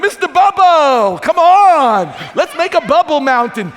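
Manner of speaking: put-on high, loud, silly kid-like voice; goofy dad playing. Dad left babysitting, playing with bubbles, high and goofy. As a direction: play like an overexcited dad with bubbles. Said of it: "I'd be going like".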